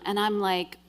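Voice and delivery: plain reading voice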